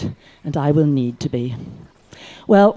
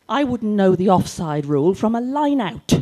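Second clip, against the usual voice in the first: ordinary talk, nothing marked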